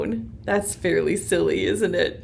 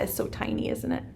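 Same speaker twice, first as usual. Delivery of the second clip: whispered; 9 dB quieter, faster than usual. Real, not acted. Peering at tiny fossil.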